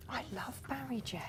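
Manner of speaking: soft adoring whisper. Real, not acted. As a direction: breathe it like a secret. Fond aside about designer.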